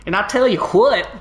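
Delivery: southern drawl. Shown as a demonstration. Streamer rereads Feldspar's line, now in a light southern drawl. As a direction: lean into a folksy southern drawl. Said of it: "Like a southern drawl?".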